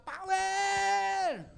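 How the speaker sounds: high, loud "Power! Grabe!" battle cry; shouted power cry. Shown as a demonstration. Speaker demonstrates the power shout to beginners, high loud yell. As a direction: yell it out with full force. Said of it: "sumigaw ka".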